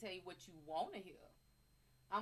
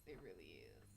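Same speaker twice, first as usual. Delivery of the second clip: whisper; 15 dB quieter than usual. Real, not acted. Streamer whispering her camera praise to herself. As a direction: repeat it in a breathy whisper. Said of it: "this camera is just so much better".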